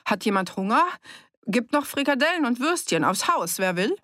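high-pitched, lilting innkeeper's call. A narrator's reading. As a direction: offer it to the whole room. Wirt ruft Essensangebot in die Runde. (in die Runde rufen)

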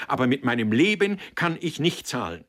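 loud, high, emphatic protest; heated refusal. A narrator's reading.

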